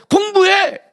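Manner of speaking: high barked order to study; shrill-free mock shout of a mother; mock parent yell. Parent yelling at studying child.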